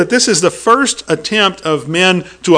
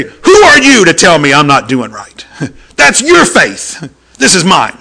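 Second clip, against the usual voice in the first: defiant retort; 5 dB louder than usual. Retold or imitated. Retold defiant student.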